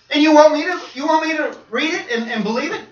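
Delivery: high, loud acted disbelief; incredulous. Preacher to man who handed him a tract, incredulous. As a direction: push the question out in astonished protest.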